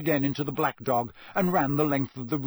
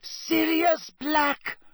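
high, slow shocked shriek of a startled mother; 4 dB louder, much slower than usual. A narrator's reading. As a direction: scream his name in horror. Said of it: "she shrieked".

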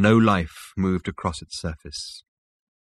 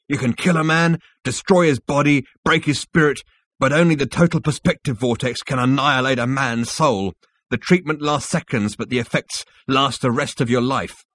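raised, louder, emphatic warning; urgent; 6 semitones higher, 4 dB louder than usual. A narrator's reading. Rooster warns Zaphod about the vortex, urgently. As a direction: drive each phrase home with urgency. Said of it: "said Rooster urgently".

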